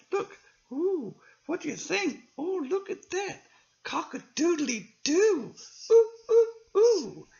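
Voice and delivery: a chirpy little puppet asking questions. Acted. Puppet reacts to rooster page.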